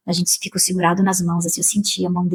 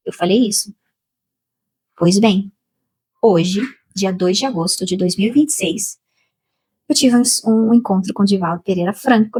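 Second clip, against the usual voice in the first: ordinary talk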